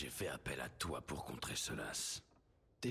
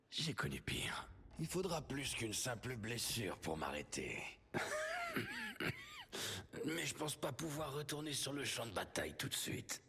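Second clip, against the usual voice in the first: voix de blessé; 7 semitones higher than usual. Acted. Varric blessé, parle faiblement.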